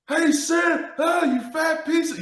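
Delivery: high, mock heckler yelling from the stands; heckling fan. Fan in the stands taunting the center, mock heckle. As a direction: play a loudmouth fan jeering.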